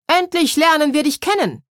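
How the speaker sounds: clumsy jester calling out to Clara; eager call. A narrator's reading. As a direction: call out full of joy. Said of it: "rief der Narrenmützenträger".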